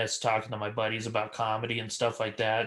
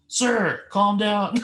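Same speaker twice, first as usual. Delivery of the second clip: loud, high imitated stage rebuke; acted comeback projected from the stage; mock calling out; 8 semitones higher, 6 dB louder, much slower than usual. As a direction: call it out to a heckler, raised and forceful. Retells comic calling back from stage.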